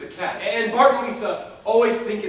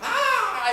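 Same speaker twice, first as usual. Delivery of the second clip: startled yelp; 12 semitones higher than usual. Retold or imitated. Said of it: "he's just like".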